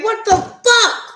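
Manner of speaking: high, slow, loud shocked cry; shocked what the fuck. Retold or imitated. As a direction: stretch it out in utter disbelief. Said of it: "And you like".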